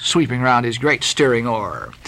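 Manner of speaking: plain reading voice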